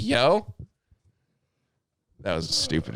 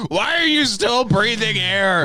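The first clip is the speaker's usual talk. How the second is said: high, drawn-out mock yell; mock outrage; 12 semitones higher, slower than usual. Friend joining in the parody of grumpy insults, mock-outraged. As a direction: wail the insult in hammed-up exasperation.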